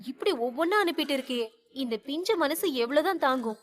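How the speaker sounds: ordinary delivery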